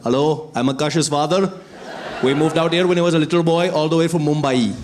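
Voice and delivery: put-on light Indian accent, polite older father; a friendly dad from Mumbai introducing himself in an Indian accent; mild Indian accent, proud and courteous. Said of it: "in the Indian accent from India".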